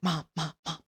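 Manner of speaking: urgent whisper rising into a high hiss; frantic whispering. Retold or imitated. Brother breaking news of a death to mom, frantic whisper. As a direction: imitate a panicked, hushed report. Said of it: "frantically whispering to my mom".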